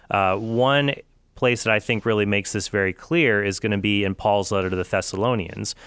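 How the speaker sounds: ordinary talk, nothing marked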